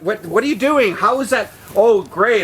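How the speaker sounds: high, loud exasperation; high, loud protest at the friend; exasperated. Real, not acted. Friend showing something unwanted.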